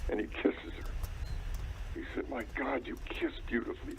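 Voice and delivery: softly whispered punchline; near whisper. Acted. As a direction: drop to an almost whisper. Joke told near whisper.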